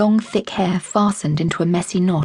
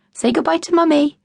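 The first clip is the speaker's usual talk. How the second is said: put-on high, sing-song nudge to the kids; a father coaxing his girls to shout goodbye; cheery dad prompt; 10 semitones higher than usual.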